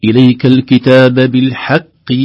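slow, loud chanted recitation; Quran recitation. Shown as a demonstration. Teacher reciting a verse for students in chanted style. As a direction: intone it like tajweed reading.

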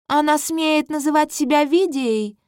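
an indignant queen crying out; outraged queen. Acted. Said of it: "восклицает королева Патриция". (возмущённая королева, высоко)

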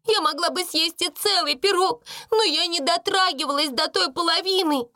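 very high, wailing denial; acted tearful squeal; tearful protest. A narrator's reading. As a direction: wail it like a crying servant girl. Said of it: "со слезами уверяла Бекки".

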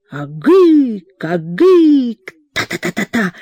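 a roaring ritual cry from the shaman. A narrator's reading. Shaman roaring at the fire while beating the drum. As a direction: roar it out like a shaman in trance. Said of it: "ревел шаман".

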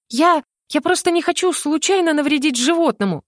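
high stammering excuse; flustered excuse. A narrator's reading. Heroine excusing herself to the mage, high and flustered. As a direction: babble an excuse, still scared.

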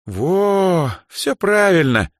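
high, drawn-out satisfied approval; slow, high, contented confirmation; pleased approval. A narrator's reading. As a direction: stretch it out, very pleased. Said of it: "довольно кивнула Даяна".

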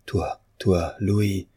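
ordinary delivery, nothing marked

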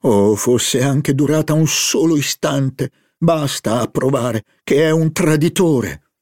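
exclaiming. Acted. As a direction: exclaim it with rising indignation. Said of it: "esclamò Morel".

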